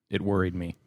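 ordinary delivery

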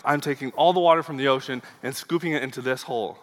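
a hint of an eager child's voice; little boy. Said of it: "the little boy with a clam in his hand".

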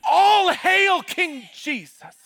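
slow, high, loud solemn hail; the wise men bowed at the feet of the toddler king; reverent proclamation. Acted. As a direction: proclaim it in awe, stretched out.

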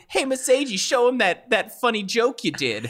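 high-pitched imitation of a pleading child; eager kid. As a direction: mimic a kid egging on the teacher. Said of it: "kids were like".

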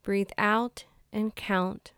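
calm, measured meditation guide with long pauses; soothing, evenly paced relaxation voice; guided meditation. Acted. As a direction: ease listeners into stillness, phrase by unhurried phrase. Leads a meditation.

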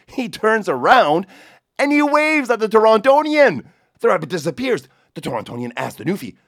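casual Newfoundland storyteller, hammed up a bit; Newfoundland accent. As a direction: tell it in a Newfoundland lilt. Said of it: "avec ma meilleure imitation du merveilleusement charmant accent celtique".

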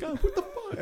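high-pitched retold disbelief; exaggerated high reaction to something gross; mock appalled. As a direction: squeal it in mock horror. Retells own appalled reaction.

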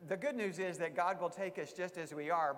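ordinary delivery

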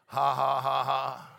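a light show of laughing; demo laughter. Shown as a demonstration. Preacher showing the church how people laugh. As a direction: let a laugh bubble up on purpose. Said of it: "Some people start to laugh".

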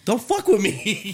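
high, drawn-out comic protest; mock disbelief. Friend joking in natural conversation, mock outraged. Jokey quote of friends' banter.

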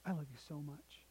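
soft, tender coaxing from a father; hushed, loving plea with hesitant pauses; pleading dad. Retold or imitated. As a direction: ask gently, as if kneeling to a child. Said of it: "got down on one knee".

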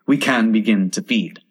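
plain reading voice

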